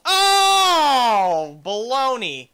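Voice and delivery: long loud wail falling in pitch; drawn-out groaning yell; dismayed cry. Real, not acted. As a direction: cry out in exasperation at a crash. Crashes in the game.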